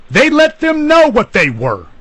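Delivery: heated emphasis; impassioned rise. Real, not acted. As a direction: pitch high in a strained, changed voice. Heated point about ethnic slurs.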